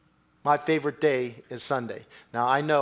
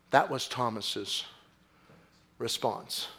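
usual speaking voice

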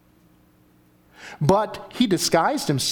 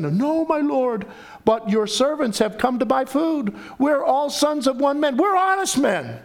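pleading brothers; 10 semitones higher than usual. Acted. Brothers plead before Joseph.

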